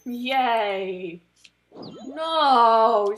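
loud, panicked yelps at the screen; frustrated outburst. Real, not acted. Reacting to a misclick in the card game, loud dismay. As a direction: blurt it out in dismay.

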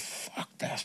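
hushed, voiceless inner curse; near-whispered retold resolve; muttered thought. Voices his own angry thought.

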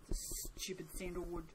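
whispered grumble; whispered, fed-up aside; disgusted whisper. Real, not acted. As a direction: hiss it at the soap under the breath. Muttering at soap.

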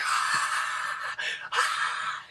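airy high-pitched shriek of joy; breathless excited scream. Retold or imitated. Winner screams back at brother, airy squeals. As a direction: let out two breathy squeals. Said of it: "I look at him, I'm like".

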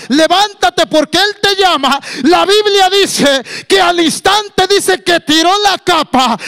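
high, loud, driving exhortation; full-force preaching. Acted. Preacher urges listeners that Christ is calling, full-force preaching. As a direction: drive it home high and loud.

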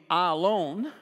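"I alone" punched, both words set apart; emphatic. Shown as a demonstration. Preacher showing how Jesus' words should be spoken, emphatic "I alone". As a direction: lean hard on "I alone" with a lifted ending. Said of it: "how that really ought to be spoken".

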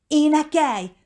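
high, loud, insistent command; urging. A narrator's reading. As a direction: press them hard to act now. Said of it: "sürgette a lányka". (hangosan sürgetve)

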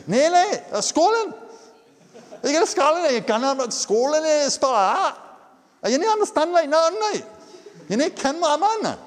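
put-on high, broad Scottish accent with Dutch words; exaggerated broad Scottish accent, on purpose. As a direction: ham up a thick Scottish accent. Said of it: "spoken with an incredibly broad accent".

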